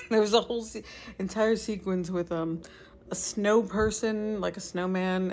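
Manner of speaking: ordinary talk, nothing marked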